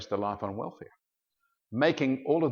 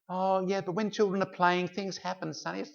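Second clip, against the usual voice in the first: higher-pitched acted mum voice; dismissive mother; 8 semitones higher than usual. Mother answers her hurt son, high-voiced acted brush-off. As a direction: brush off a complaining child in a mum's voice. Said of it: "You've got to go to school".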